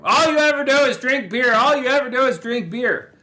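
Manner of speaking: high, loud mock nagging yell; imitated wife yelling. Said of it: "she starts yelling at you".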